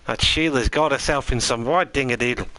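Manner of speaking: a cheeky hint of an Aussie accent; mock Aussie accent. Streamer to chat, trying out the promised Aussie accent. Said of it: "in a full Aussie accent".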